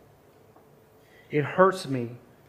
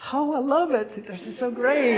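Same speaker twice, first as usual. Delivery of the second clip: much higher, louder mock squeal of delight; gushing girls; 11 semitones higher, 6 dB louder than usual. Staff girls gushing over a cheap ornament, high and mock-delighted. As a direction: ham up the girls' fake excitement.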